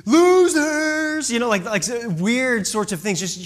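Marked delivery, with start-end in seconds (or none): 0.1-1.3 high, loud mocking yell; yelling back. Crowd yelling back at the bullhorn preacher, a loud high jeer. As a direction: shout it back at the protesters. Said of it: "they were just yelling back".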